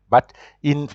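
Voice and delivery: ordinary talk, nothing marked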